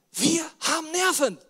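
loud, slow, high outburst; indignant exclamation. Real, not acted. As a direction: throw the line out with indignation. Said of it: "beschweren wir uns bei Gott". (laut und langsam empört)

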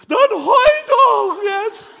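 very high, loud, drawn-out wail; hammed-up bawling. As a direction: wail the demand in mock tears. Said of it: "die läuft so weiter und weint". (übertrieben heulendes Kreischen)

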